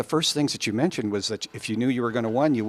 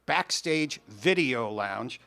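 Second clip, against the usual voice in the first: hype announcer intro; 4 semitones higher, 5 dB louder, slower than usual. Acted. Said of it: "Nice introduction".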